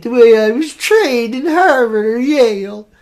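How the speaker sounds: slow, drawn-out mock Ivy League snob; nose-in-the-air snob. Host mocking people who correct his dialect, stretched snooty voice. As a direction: put on a snooty, over-educated voice. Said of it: "with our nose up in the air".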